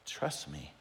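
a hushed, gentle trust me; soft God voice. Pretend. God answering the doubter, hushed and gentle. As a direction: say it softly, reassuring. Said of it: "And God's like".

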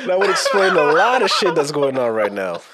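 loud, real laughter through the words; laughing hard. Real, not acted. Repeating the retrograde excuse to the co-host, laughing hard. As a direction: crack up while saying it loudly. Cracking up over retrograde explanation.

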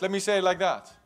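ordinary talk

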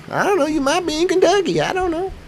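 mock-innocent high squeak; squeaky joke voice. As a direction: jump way up into a silly high voice. Joking about where listeners live.

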